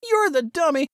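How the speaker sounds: put-on high, wounded kid voice; boy Saul, hurt. A narrator's reading. Saul complains to his brother, high and hurt. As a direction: protest like a boy near tears.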